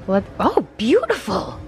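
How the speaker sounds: usual speaking voice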